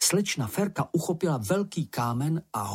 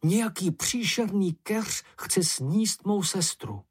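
terrified cry; 7 semitones higher than usual. A narrator's reading. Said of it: "modrý hrůzou a křičel".

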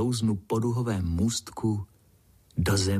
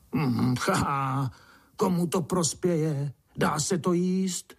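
slow, higher-pitched grumbling voice; old ant's mumble; 6 semitones higher, slower than usual. A narrator's reading. Old ant doubts any use in it, grumbling. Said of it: "zamumlal starý mravenec".

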